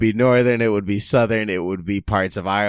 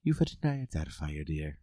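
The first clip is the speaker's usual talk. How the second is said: softer nanny voice saying Euphigenia Doubtfire, trailing off on dear; Mrs Doubtfire voice; 3 semitones lower, 10 dB quieter than usual. Pretend. Voicing Mrs Doubtfire after name.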